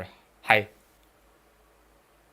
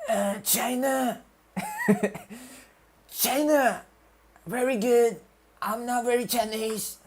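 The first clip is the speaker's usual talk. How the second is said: exaggerated Trump imitation, pitched up; Trump impression; 9 semitones higher than usual. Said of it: "My Trump impression is like".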